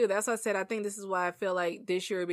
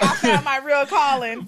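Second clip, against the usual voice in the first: laughing; 6 semitones higher, 7 dB louder, slower than usual. Retold or imitated. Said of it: "He's like".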